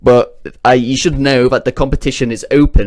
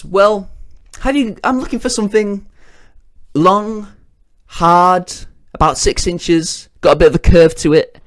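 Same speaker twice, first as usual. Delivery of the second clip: higher, halting, suggestive voice; suggestive description; 7 semitones higher than usual. Acted. Dan describing his wish to the manager, lightly suggestive. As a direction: drag out each innuendo word.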